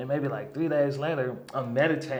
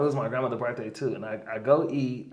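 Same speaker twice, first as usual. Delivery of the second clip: ordinary talk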